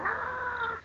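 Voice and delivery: schriller gespielter Schrei; high mock scream. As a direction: squeal it out in mock terror. Scream to scare off creeper.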